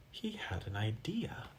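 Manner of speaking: hushed secret whisper. Acted. Story reader whispers.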